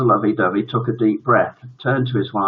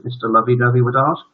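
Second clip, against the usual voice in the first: usual speaking voice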